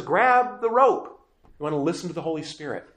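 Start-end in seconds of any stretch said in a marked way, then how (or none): 0.0-1.2 high, slow call of grab the rope. Retold or imitated. Holy Spirit calls to the drowning soul, high and slow. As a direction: stretch it out like a call across water. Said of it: "he's the one who says".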